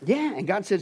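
ordinary talk

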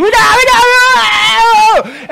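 shrill high, loud and slow panic; hammed-up panicked wife shrieking; mock wife scream. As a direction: scream it like a startled passenger. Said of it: "the wife starts screaming".

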